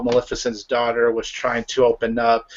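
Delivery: ordinary talk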